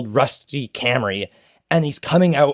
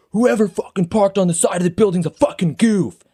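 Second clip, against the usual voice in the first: high-pitched mock yell of an irate stranger; retold yell; 8 semitones higher than usual. Irate driver to the whole Tim Hortons, retold yell. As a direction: act out yelling across a shop. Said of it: "just yells into the whole Tim Hortons".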